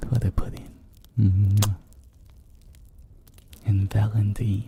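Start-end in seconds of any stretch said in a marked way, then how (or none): 1.2-1.8 loud "mwah" kiss; affectionate smooching mwah; kiss sound. Pretend. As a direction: blow the listener an audible kiss. Blown kiss after thanking viewer.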